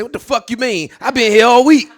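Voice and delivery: coworker's angry protest about pay; indignant outburst. Retold or imitated. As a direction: protest like you've been cheated. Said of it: "He looked at me and said".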